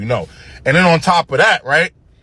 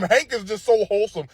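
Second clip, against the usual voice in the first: normal talking voice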